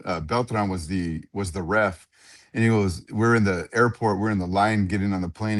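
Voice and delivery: normal talking voice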